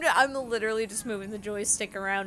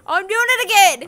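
high, loud cry of discovery; excited yell; 8 semitones higher, 11 dB louder than usual. Real, not acted. Player finding the trick works once more, excited high yell. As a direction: call it out loudly, thrilled.

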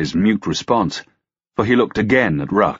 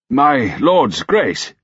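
slow, higher-pitched word of warning; measured, raised-pitch warning; 6 semitones higher, 4 dB louder, much slower than usual. A narrator's reading. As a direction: caution a superior, drawing the words out. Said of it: "Sir Robert said warningly".

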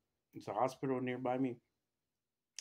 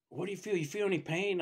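higher, louder retold questioning; frantic nurse; 8 semitones higher, 6 dB louder than usual. Nurse quizzing a patient on the treadmill, frantic. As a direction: imitate a nurse urgently pressing for answers. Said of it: "the nurse starts going crazy on me".

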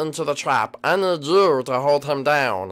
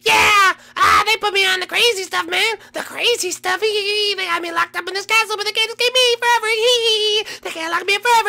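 very high, loud, manic squeal; ecstatic Lemmy; 6 dB louder than usual. Acted. Lemmy greets his cousin in a high manic squeal. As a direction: bounce off the walls with giddy glee.

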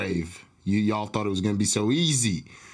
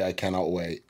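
ordinary delivery

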